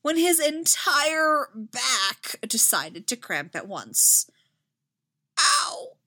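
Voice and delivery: slow, strained, then a sharp high ow; injured knight gasping out his story; choking in pain. Acted. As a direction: force the words out through a cramp. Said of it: "Anakin choked off in pain".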